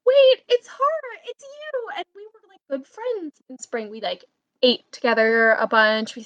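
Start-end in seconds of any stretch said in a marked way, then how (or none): 0.0-1.9 high, slowed, delighted; retold happy surprise; excited recognition. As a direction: squeal of recognition. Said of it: "so I was like".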